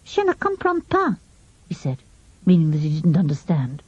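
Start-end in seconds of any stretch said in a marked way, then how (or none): 0.0-1.2 put-on boy faking a French accent, high and soft; a high schoolboy bluffing in French; mock French, pitched up; fake French. A narrator's reading. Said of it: "pretend he was French again".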